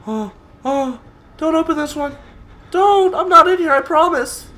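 high frantic pleading; panicked pleading. Real, not acted. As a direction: beg in panic. Pleading as enemy searches.

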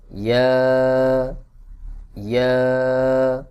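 ইয়া with a long held final vowel; drawn-out letters. Shown as a demonstration. Teacher models the last letters, each ইয়া drawn out. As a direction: stretch ইয়া on a sustained note, both times. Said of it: "আমি যেভাবে টান দিয়ে পড়ব".

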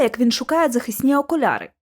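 ordinary delivery

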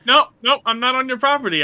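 nervous. Pretend. Backing off from threat.

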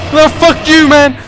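very high, loud angry yell. Real, not acted. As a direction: blast it out in frustration. Frustrated at the game.

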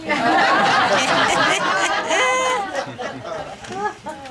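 loud, high gleeful laughter; real amused laughter; hearty laugh. Real, not acted. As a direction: let the laugh ring out. Laughing after party joke.

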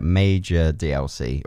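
ordinary talk, nothing marked

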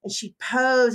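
usual speaking voice

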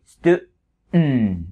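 "stu-" cut off, then a nasal hum; choked breath. Shown as a demonstration. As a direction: stop the breath, release it through the nose. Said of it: "nghẹn hơi".

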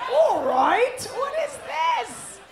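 lightly mocked British accent, loud and high. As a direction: imitate a British man, stretching the last words. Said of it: "And then he's like".

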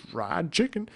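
high, put-on voice for Joe; roommate voice. Joe answering Steve about dinner, in a high put-on voice. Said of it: "he'd be like".

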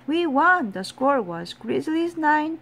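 high, loud, elated news; excited announcement. A narrator's reading. Jimmy telling the player about the win, excited loud announcement. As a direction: announce the victory, starting at a shout.

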